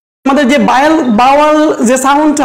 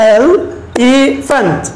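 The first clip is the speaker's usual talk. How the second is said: "Elephant" drawn out very slowly as e-li-phant, one beat each; slow clapping demo; clapped syllables; much slower than usual. Shown as a demonstration. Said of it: "ক্লেপের সাহায্যে বলি".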